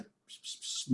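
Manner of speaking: soft voiceless "pss, pss, pss"; hissed little sounds to summon a cat; cat-calling psst. Shown as a demonstration. Student showing how his culture calls a cat, soft psst cat call. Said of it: "pra chamar um gato a gente faz".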